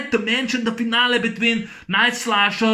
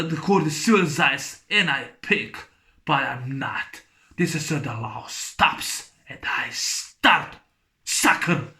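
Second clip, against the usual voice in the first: Cobra's growl; 4 semitones lower, 3 dB quieter than usual. Retold or imitated. Quotes Cobra's low threat.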